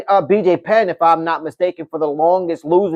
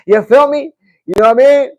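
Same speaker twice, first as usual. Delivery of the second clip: laughing; 8 semitones higher, 5 dB louder than usual. Real, not acted. Laughing after [name] joke.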